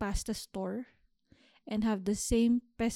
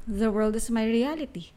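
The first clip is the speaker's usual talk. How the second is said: ordinary delivery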